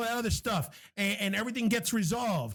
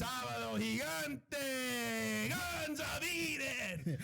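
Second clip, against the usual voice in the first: long, drawn-out game-show announcer call; Sábado Gigante announcer; 5 semitones higher, 10 dB quieter, much slower than usual. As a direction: stretch every word like a Spanish variety-show host. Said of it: "doing, like, the Sábado Gigante version".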